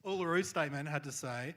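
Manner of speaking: normal talking voice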